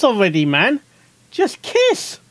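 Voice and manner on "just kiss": high, pleading just kiss; squealed demand; excited plea. Real, not acted. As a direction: beg for the kiss. Urging characters to kiss.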